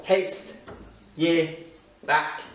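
Scottish phrase, word by word with pauses; Scottish accent. Shown as a demonstration. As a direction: spell out each Scottish word slowly. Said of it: "Tu verras cette expression". (accent écossais, mot par mot)

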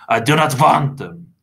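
forceful vampire protest; growled refusal. A narrator's reading. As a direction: growl a firm objection. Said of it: "Mister Crepsley growled".

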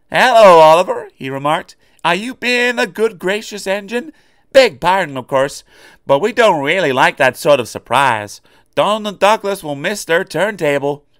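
mock him politely over the wreck; teasing engine. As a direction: Duck teasing Oliver about the crash. Duck's teasing voice.

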